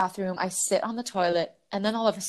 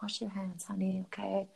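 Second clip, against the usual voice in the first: hushed, tender caretaker voice; very sweet. Retold or imitated. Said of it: "she's very sweet".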